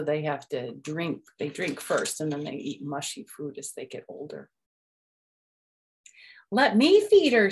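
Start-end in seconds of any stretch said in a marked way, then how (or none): 6.5-7.5 put-on high, loud eager little boy; pleading child frog voice; eager kid frog. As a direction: beg to do it yourself. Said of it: "said Froggy".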